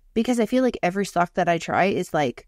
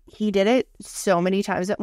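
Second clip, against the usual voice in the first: ordinary delivery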